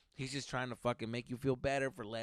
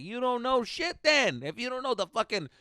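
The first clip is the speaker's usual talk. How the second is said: indignant retort; 6 semitones higher than usual. Acted. Telling off the man who forgot the chemical's name, indignant. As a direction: lay it down word by word, raised pitch. Acted retort he thought in head.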